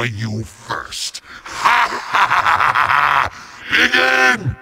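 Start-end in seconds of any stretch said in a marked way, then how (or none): 1.5-3.3 long theatrical villain cackle; evil laugh. Acted. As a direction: cackle in gloating triumph. Villain gloating at the hero.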